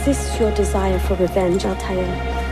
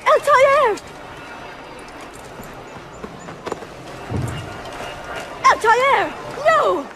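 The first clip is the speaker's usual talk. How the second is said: very high, desperate cry; alarmed scream; much slower than usual. Acted. Wife trying to stop her husband, desperate scream. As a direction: scream his name in panic.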